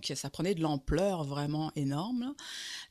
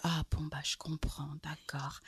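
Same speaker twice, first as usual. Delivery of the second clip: soft, fading whisper; meek whisper; 3 semitones lower, 7 dB quieter than usual. Retold or imitated. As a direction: accept the no gently under the breath. Man accepts her refusal.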